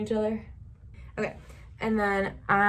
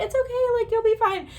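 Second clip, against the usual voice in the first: high, loud sweet reassurance; imitating the girl reassuring; 4 dB louder than usual. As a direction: comfort her brightly, pitched way up. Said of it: "She's like".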